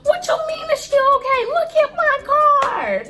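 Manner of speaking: very high, incredulous outburst; indignant yell. Retold or imitated. Retelling her outburst at her brother over the car, indignant yell. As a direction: yell it high, dragging out the last words.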